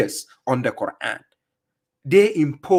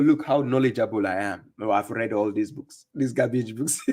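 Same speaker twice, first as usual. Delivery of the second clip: mock boast; 5 semitones lower, 4 dB quieter than usual. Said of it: "They are trying to tell you".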